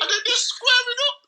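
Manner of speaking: high, cracking excited yell; squealing excited storytelling; excited shout. Real, not acted. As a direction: shout it out in disbelief. Excited telling of the fight.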